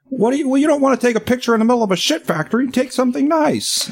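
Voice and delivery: high-pitched imitation of an indignant father; mock-scold like an exasperated dad, pitched well up; upset dad. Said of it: "the funniest things when he's upset".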